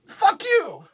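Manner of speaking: very high-pitched comic curse; exasperated outburst. Real, not acted. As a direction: spit out the curse in squeaky outrage. Ends rant cursing at mispronouncers.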